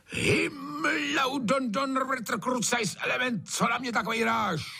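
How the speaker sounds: very high-pitched string of oaths; furious cursing. A narrator's reading. Trautenberg swears across all the mountains, high furious outburst. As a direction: curse so the whole mountains hear. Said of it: "ozve se na celý hory".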